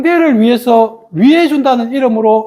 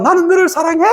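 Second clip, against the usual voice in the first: acted high-pitched love declaration; lovey declaration; 6 semitones higher, faster than usual. Immature lover to partner, high-pitched mock declaration.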